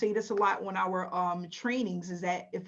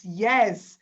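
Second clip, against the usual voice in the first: ordinary talk, nothing marked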